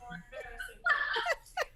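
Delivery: high, bursting real laugh; a real embarrassed laugh. Real, not acted. Laughing after punchline.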